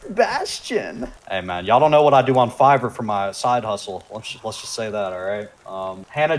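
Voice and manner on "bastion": breathy cutesy high voice; airy, girly and much higher; e-girl voice. Pretend. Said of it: "my e-girl voice".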